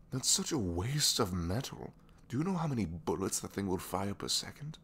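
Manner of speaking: ranting in disbelief at a pointless demand; exasperated retort. Retold or imitated. Gadget maker questioning an absurd gun blueprint, exasperated. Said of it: "And I'm just like".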